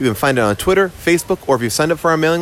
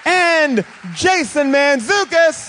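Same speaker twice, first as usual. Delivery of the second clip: host announcement shout; 11 semitones higher, slower than usual. Pretend. Introducing co-hosts on stage.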